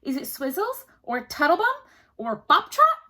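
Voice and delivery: queen hopefully tries silly names on the little man; queen trying odd names. A narrator's reading. Queen tests odd names, high and loud. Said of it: "She tried more and more unusual names".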